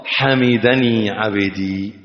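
soft, low and slow; hushed reverent reply; tender divine voice. Acted. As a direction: say it gently, as God answering. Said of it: "قال الله".

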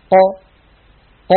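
"ق" بتباعد الطرفين دون إغلاق; قاف رخوة بلا انحباس; loose qaf. Shown as a demonstration. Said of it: "بدلا من ذلك تخرج هكذا". (قاف خاطئة بتباعد طرفي المخرج)

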